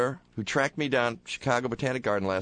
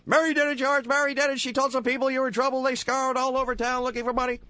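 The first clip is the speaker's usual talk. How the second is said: put-on much higher, rushed excited old uncle; Uncle Billy; 14 semitones higher, faster than usual. Uncle rushes in to tell George, high and fast. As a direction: blurt the news fast and high. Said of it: "Uncle Billy saying something like".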